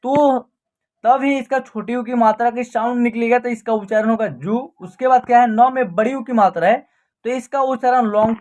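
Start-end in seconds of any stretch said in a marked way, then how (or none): 4.3-4.6 जू said short, without stretching the vowel. Shown as a demonstration. Teacher showing the short u sound in जू, not drawn out. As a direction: keep the vowel in जू brief. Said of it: "इसका उच्चारण short तरीके से होगा".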